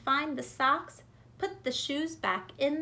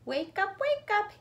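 put-on calling a sleepy child awake; mama coaxing a dozing kid awake, rising; wake-up call; 7 semitones higher than usual. A narrator's reading. Mama waking llama in car.

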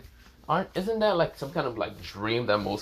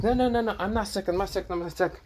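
put-on high-pitched flustered girl, words tumbling repeatedly; flustered denial; 9 semitones higher than usual. A narrator's reading. Blushing girl assures her childhood friend she isn't sick, flustered. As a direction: rattle off a high, embarrassed protest.

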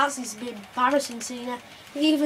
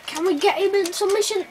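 eager raised voice; excited; 8 semitones higher, 6 dB louder than usual. Real, not acted. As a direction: push hard for the submission. Excited gaming commentary.

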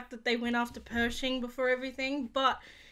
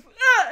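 mock attack yell; 10 semitones higher, 10 dB louder than usual. Gideon's attack on Din.

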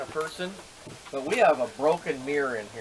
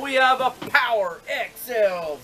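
infomercial; 5 semitones higher, 5 dB louder, slower than usual. Acted. Continues infomercial pitch.

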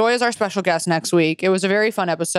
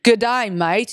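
convincing put-on Aussie greeting; mock-Australian "good day, mate"; Australian accent; much slower than usual. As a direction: show how an Australian says it. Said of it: "that was actually pretty good".

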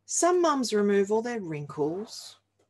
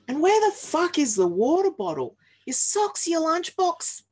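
high, loud frazzled yelling; exasperated mum; 9 semitones higher, 6 dB louder than usual. Acted. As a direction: shriek it like a mum losing it in the morning rush. Frazzled mum searching belongings.

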